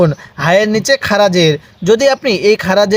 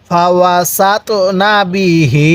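"ফা ওয়া সাত না বিহি" stretched slowly, syllable by syllable; slow recitation demo; slower than usual. Shown as a demonstration. Teacher leading students through a Quran phrase, slow. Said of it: "আমার সাথে পড়ুন".

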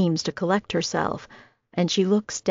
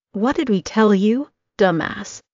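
angry snap; 3 semitones higher than usual. A narrator's reading. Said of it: "he snaps at the fitting room assistant".

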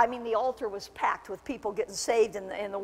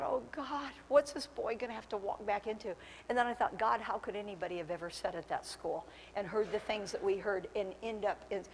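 quiet, inward musing; soft inner thought; 2 semitones lower, 11 dB quieter than usual. Retold or imitated. Preacher recalling his own dismayed thoughts, quiet and inward. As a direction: say it to yourself, much softer. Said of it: "inner thought, softly retold".

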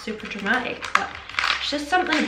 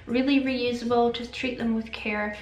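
ordinary talk, nothing marked

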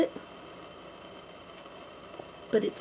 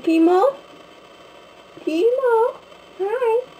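sing-song pet talk, pitched way up; cooing to the cat; 14 semitones higher, 4 dB louder, much slower than usual. Real, not acted. Talking sweetly to her cat.

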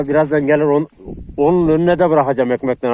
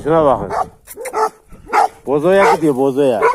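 ordinary delivery

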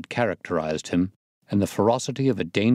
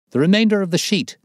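much higher, louder and fast; thrilled loud exclamation; excited cry; 12 semitones higher, 7 dB louder, faster than usual. Acted. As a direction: blurt it out in amazement. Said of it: "cried the inspector".

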